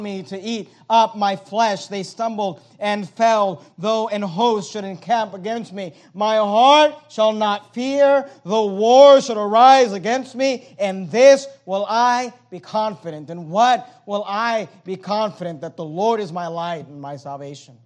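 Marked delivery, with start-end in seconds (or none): 6.1-13.3 slow, loud declaration; preaching at full force. Acted. Pastor declaiming the psalm, slow and loud.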